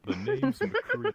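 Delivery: slow, loud, high cowboy drawl; McCree cowboy voice. Pretend. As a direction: drawl it out like a gunslinger. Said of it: "I wanna hear what your deep voice sounds like".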